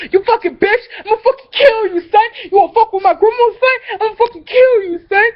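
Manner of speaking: high, fast, tearful rage; imitating a crying, furious cousin; furious threat. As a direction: scream threats through tears.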